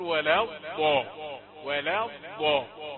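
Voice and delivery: ولا الضاء with ض loose and drawn, slowly; soft dad. Shown as a demonstration. Teacher drilling the soft ض in ولا الضاء. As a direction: relax ض with no stop in ولا الضاء. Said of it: "فيها رخاوة عكس الشد".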